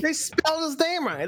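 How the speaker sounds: high-pitched mock complaint. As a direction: whine it like a petty complaint. High mocking repeat of the quote.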